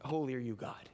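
words failing him; choked off. Retold or imitated. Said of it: "he can't even get the words out".

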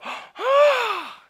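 high, drawn-out amazed gasp; awed gasp. Retold or imitated. Crowd seeing the dove fly out, awed gasp. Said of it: "people would go".

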